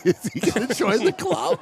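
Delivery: laughing mid-question; a question broken by laughter. Real, not acted. Joking about the club.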